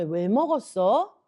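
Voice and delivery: slow, unaccusing question; gentle questioning. Acted. God asking Eve why she ate it, gentle questioning. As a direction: ask patiently, wanting to hear the reason. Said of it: "네가 왜 그런 행동을 했는지 하나님 물어보시는".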